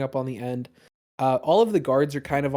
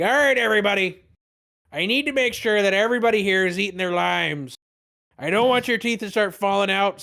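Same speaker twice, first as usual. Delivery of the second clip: higher, louder crew boss addressing the prisoners; first mate lecturing prisoners about limes, raised and bossy; 5 semitones higher, 4 dB louder than usual. Acted. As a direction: lay down the rules to the crew, a bit pushy. NPC Gallium addresses prisoners.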